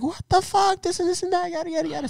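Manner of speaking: very high-pitched mock nagging; complaining girlfriend. Girlfriend objecting to shocked dog, high mock complaint. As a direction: imitate her outrage up high, fading as it goes. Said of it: "My girl was like".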